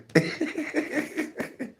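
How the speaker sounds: high giggling laugh at own story; high-pitched laugh. Real, not acted. Man laughs at own story about mama, high-pitched laugh.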